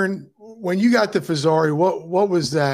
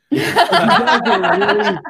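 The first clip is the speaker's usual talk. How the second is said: laughing, high and louder; gushing through a laugh; 12 semitones higher, 7 dB louder, slower than usual. Real, not acted. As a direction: gush about them while laughing.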